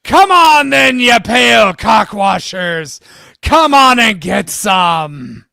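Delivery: roaring war cry, high and loud; battle shout. Acted. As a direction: bellow at the enemy like thunder. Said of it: "his voice cutting the night like thunder".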